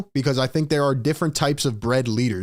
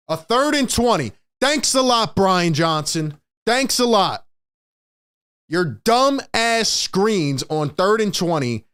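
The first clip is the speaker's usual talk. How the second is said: higher, biting sarcastic thanks; sarcastic outburst; 4 semitones higher, slower than usual. Real, not acted. Fan blasts Brian Johnson's play call with higher sarcastic thanks.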